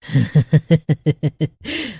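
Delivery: witch cackle. A narrator's reading. Witch cackling at the newcomer's arrival. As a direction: cackle with glee. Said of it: "चुड़ैल बोलती है".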